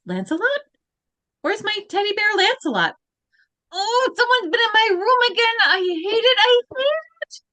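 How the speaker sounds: ordinary talk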